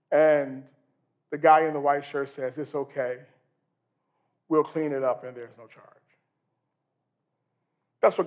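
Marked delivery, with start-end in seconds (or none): 2.6-5.9 reassuring. Acted. Kind clerk reassuring mother.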